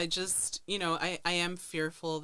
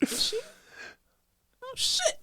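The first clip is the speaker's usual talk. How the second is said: much higher and louder, startled; dawning realization; 9 dB louder than usual. Retold or imitated. Friend realizing the stranger meant her, startled realization. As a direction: catch on suddenly. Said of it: "'cause I'm like".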